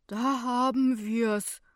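slow, drawn-out grumble; grumbling older man. A narrator's reading. Older passenger sees man collapse, grumbles disapprovingly. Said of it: "murrt ein älterer Fahrgast".

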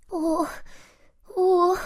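high, frightened mouse "oh"; ängstliche Maus. A narrator's reading. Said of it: "weil sie sich ängstigte". (hohe, bange Mäuselaute)

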